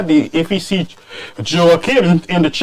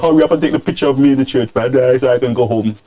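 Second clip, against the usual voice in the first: fast, lower mock hurry-up; impatient churchgoer imitated; 7 semitones lower, faster than usual. Churchgoer to photographer, impatient mock grumble, fast. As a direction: rush the line like you want to go home. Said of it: "He's like".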